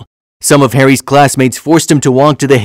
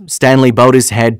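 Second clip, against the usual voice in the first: plain reading voice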